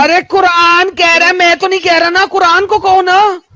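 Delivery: high-pitched insistent protest; heated shouting. Real, not acted. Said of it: "इतना चिल्ला चिल्ला के लाउडस्पीकर की तरह".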